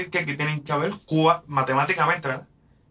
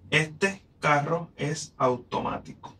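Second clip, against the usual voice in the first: slowed, each syllable clear; measured demo reading; slow verse reading; slower than usual. Shown as a demonstration. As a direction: read it slowly for counting. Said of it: "Vamos a ver si es verdad".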